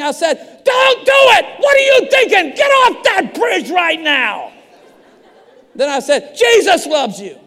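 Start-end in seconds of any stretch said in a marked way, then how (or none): 0.6-4.5 full-force, very high-pitched yell; frantic shout. Retold or imitated. Pastor records plea to man on overpass, frantic shouting. 6.4-7.4 loud, high, hammed-up yell; shouted plea. Pastor adds to recording for the jumper, shouting.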